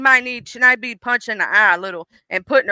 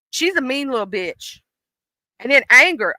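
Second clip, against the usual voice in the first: ordinary talk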